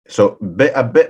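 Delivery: ordinary talk, nothing marked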